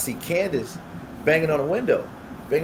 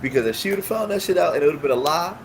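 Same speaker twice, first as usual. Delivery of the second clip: high, heated scolding of friends; re-enacting an indignant rebuke; retold telling-off; 6 semitones higher, 3 dB louder than usual. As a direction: lay into them, voice pitched up.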